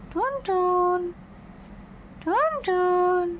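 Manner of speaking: calling the pet Tuntun in a high sing-song; sing-song name call. Real, not acted. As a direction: sing the pet's name up high, stretching it slowly.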